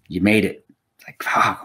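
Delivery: hushed, breathless whisper of disbelief; awed whisper. Retold or imitated. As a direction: whisper it like you can't believe you made it. Retold awe at being told passed.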